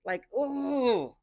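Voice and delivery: loud, high exclamation at the screen; excited viewer yelling at the episode; loud reaction. Real, not acted. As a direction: shout agreement at the TV. Reacting loudly to the show.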